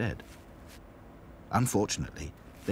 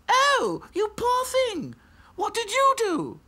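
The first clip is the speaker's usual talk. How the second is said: high haughty doll voice; snooty little princess; 7 dB louder, slower than usual. A narrator's reading. Princess dolls.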